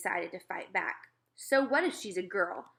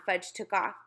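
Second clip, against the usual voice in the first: ordinary delivery, nothing marked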